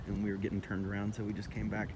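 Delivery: normal talking voice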